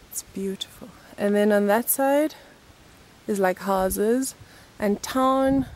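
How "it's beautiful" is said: hushed admiration. Real, not acted.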